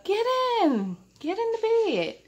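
high, coaxing big-brother order; high, sing-song coaxing into bed; sweetly bossy brother. Retold or imitated. Brother tucking her in.

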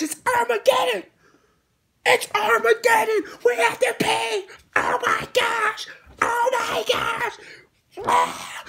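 mock the outraged fans in a shrill panic; mock hysterical fan. Host mocks viewers whining about a cheap pay-per-view, high hysterical. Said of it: "people were pissed off".

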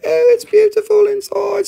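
high, delighted gush at the inside; mock delight. Wowed buyer opening a rock, high delighted gush.

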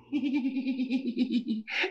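high, rippling staccato cackle; high doll cackle. Acted. Killer doll claims he is good, then cackles high. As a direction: cackle in a long high run.

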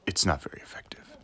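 whispered game verdict; deadpan whisper of the result. Pretend. Pokémon battle text parody.

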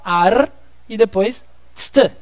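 AR first, then TST on its own; separated sounds. Shown as a demonstration. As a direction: split it into ar, pause, tst. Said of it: "Gente, separa. Vamos falar".

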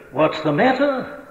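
usual speaking voice

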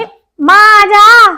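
high, slow call across the yard. Acted. Daughter calling her mother into the cool room, a high drawn-out call.

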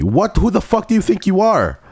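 high-pitched indignant shriek; affronted, pitch leaping high; shocked outburst. Real, not acted. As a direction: shoot up into a startled squawk. Reacts to unexpected game character.

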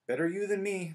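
both friends blurting it at once; emphatic chorus. Acted. Ron and Hermione warning Harry about Snape, emphatic. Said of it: "they said together".

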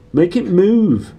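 put-on high, loud, slow spoilt-child whine; whining Dudley. A narrator's reading. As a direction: stretch it out like a spoilt boy nagging his dad. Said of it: "he whined at his father".

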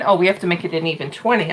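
usual speaking voice

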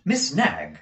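plain reading voice

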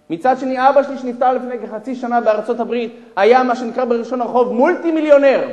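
high, loud storytelling, dropping at the end; acted forceful account; impassioned explanation. Millionaire telling of his late father's fortune, impassioned.

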